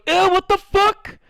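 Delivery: very high, loud, slow shriek; squeaky voice recoiling; disgusted squeaky voice. Retold or imitated.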